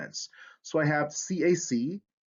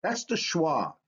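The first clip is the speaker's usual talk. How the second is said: usual speaking voice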